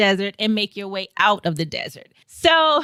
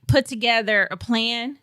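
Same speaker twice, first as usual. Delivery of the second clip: normal talking voice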